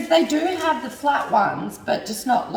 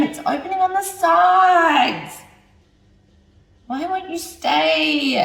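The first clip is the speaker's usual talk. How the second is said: high, slow, louder whine at the wrap; pitched-up drawn-out frustrated whine; exasperated whine; 6 semitones higher, 3 dB louder, slower than usual. Real, not acted. Wrap keeps opening, despair.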